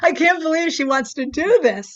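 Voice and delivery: amused, exaggerated disbelief; mock disbelief. Voicing disbelieving teammates and coaches.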